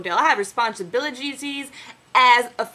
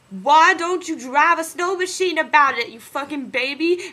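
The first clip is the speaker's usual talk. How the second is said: slow, louder, higher mocking taunt; 6 semitones higher, 6 dB louder, slower than usual. He taunts Todd at the fountain, slow and mocking. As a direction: drag it out to mock him. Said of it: "I just said".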